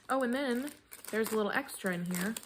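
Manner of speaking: ordinary delivery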